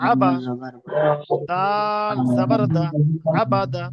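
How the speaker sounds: letter names drawn out very long, pauses between pieces; slow, segmented recitation drill; spelling piece by piece. Shown as a demonstration. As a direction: chant out each letter slowly, then join the syllables. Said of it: "কেটে কেটে বানান করবো".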